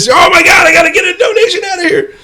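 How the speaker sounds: high, loud, frantic yell; mock shout. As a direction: holler like a boy greedy for donations. Acted Henri bragging about collections.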